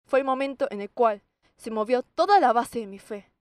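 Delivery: normal talking voice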